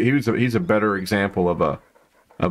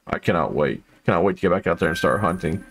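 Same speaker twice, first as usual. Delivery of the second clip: ordinary talk, nothing marked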